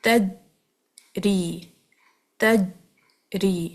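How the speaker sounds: تجری very slow, jeem held and stopped; blocked jeem. Shown as a demonstration. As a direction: hold the jeem blocked without bounce. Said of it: "آپ پڑھیں".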